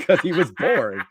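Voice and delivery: laughing through the words. Real, not acted. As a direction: chuckle while explaining. Laughing at the boring-voice remark.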